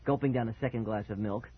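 usual speaking voice

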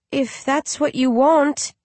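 put-on high, slow, hesitant little child; a baby unsure of what it's asked; uncertain baby. A narrator's reading. Baby answers its mother slowly and uncertainly. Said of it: "Holy Moly says uncertainly".

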